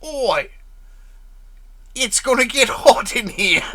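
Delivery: high, loud hammed-up cockney taunt; British cartoon accent. Another try at Pyro, loud cockney. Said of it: "I can't do his accent from the cartoon".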